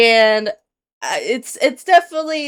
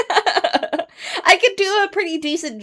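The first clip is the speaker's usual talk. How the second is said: said through a laugh; 9 semitones higher, slower than usual. Real, not acted. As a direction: chuckle through the words. Laughing at own mastery.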